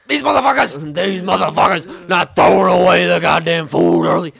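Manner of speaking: an enraged kitchen boss roaring at his cooks; furious ranting chef. Retold or imitated. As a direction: blow up like an old chef losing it. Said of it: "he was like Joey Diaz going off".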